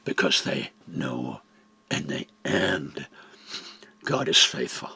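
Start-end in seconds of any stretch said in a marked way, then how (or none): none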